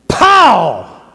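sudden loud high pow. Shown as a demonstration. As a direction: pop it out like the cork. Cork bursting out.

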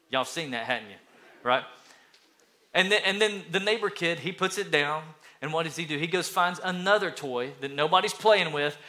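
ordinary delivery

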